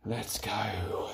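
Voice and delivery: whispered hype to self. Real, not acted. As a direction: whisper it under breath. Psyching up before final set.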